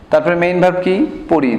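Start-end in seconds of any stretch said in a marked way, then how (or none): none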